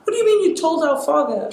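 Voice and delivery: quick, high-pitched incredulous question; retold disbelief. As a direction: re-enact being floored by mom's news. Retold shocked reaction to mom.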